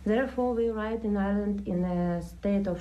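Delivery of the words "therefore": usual speaking voice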